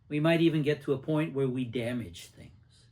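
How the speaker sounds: ordinary delivery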